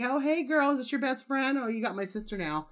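put-on high, sassy "hey girl" taunt; sweetly taunting her rival; sassy confrontation. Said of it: "be like".

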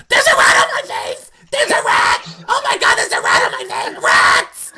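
shrieking high in panic, laughing; hysterical scream. Retold or imitated. Castmate mocking Karishma waking camp, panicked shriek. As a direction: scream like a rat is on your face. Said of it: "Karishma's like".

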